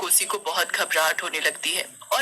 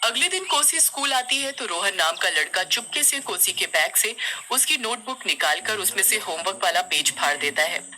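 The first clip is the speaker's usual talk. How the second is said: usual speaking voice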